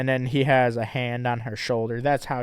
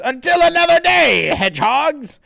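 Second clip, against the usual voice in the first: villain voice; 13 dB louder than usual. Acted. Comic villain farewell.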